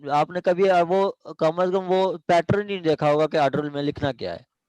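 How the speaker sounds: usual speaking voice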